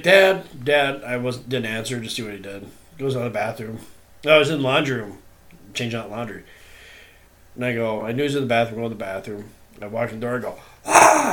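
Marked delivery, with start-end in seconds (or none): 0.0-1.0 higher, louder calling for Dad; child calling. Retold or imitated. Six-year-old son calling for his dad, higher and louder. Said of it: "and he's like". 10.8-11.3 much higher, louder sudden scare shout; jump scare scream. Retold or imitated. Father scaring his son in the bathroom, sudden loud high scream. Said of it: "I just scream at him".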